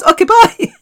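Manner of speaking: very high, sing-out goodbye; comically high-pitched dismissal; mock breezy sign-off. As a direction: squeak a hammed-up farewell. Retold cheery goodbye after shock.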